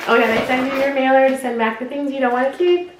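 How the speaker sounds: normal talking voice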